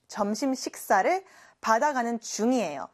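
usual speaking voice

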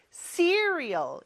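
"시리얼" as English cereal, high stressed first syllable. Shown as a demonstration. Teacher showing where cereal is stressed, first syllable punched. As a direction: hit the "si" of "시리얼", let the rest fall. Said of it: "이름절에 강세가 있습니다".

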